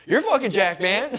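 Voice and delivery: high, loud excited echo; amazed retold reply. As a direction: blurt it back, imitating a thrilled stranger. Stranger's thrilled echo of the compliment.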